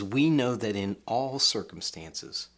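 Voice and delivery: "We know that in all circumstances" slow and deliberate; measured stress on "We" and "all"; slow emphatic quote. Shown as a demonstration. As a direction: press each word home. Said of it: "the Apostle Paul is emphatic here".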